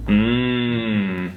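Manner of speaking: hummed mmm of delight; mmm over pizza. Shown as a demonstration. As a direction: hum like tasting great pizza. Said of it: "you're gonna go".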